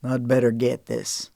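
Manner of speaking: a hint of a low male voice; casual excuse, low and easy; lightly voiced man. Man excusing himself from the table, lightly voiced.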